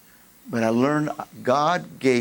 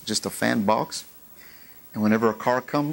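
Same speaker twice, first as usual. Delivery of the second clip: ordinary delivery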